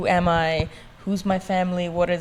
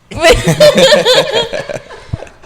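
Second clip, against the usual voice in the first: loud, high-pitched laughing fit; burst of laughter; 9 dB louder than usual. Real, not acted. Bursts out laughing when asked for accent.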